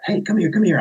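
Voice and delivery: put-on high sing-song call to the kids; dad calling his kids over to read. Dad calls kids over, high sing-song call.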